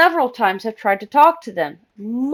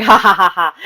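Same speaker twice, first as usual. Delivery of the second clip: mock laugh; 4 semitones lower, 5 dB louder than usual. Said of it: "Bun Bun's like".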